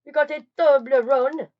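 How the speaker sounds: loud, slow, high mock announcer voice; odd put-on voice. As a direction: boom the name out like a showman. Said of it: "I don't know why I said it like that".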